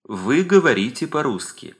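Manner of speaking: usual speaking voice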